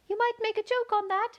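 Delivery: very high, small gnat voice; very small voice at her ear; tiny voice. A narrator's reading. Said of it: "the little voice close to her ear".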